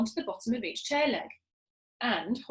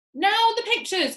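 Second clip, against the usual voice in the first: high, loud slow call; crying out; 9 semitones higher, 6 dB louder, slower than usual. Acted. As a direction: call out the next job. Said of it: "cried Muggle Rump".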